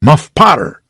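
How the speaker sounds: high loud cry of the name; shouted name. A narrator's reading. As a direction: call the name across the crowd. Crowd names the suspect.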